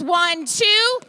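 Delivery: loud coach count-off; shouted punch numbers; called-out counts. Shown as a demonstration. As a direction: bark the numbers out to the class. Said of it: "Call the numbers".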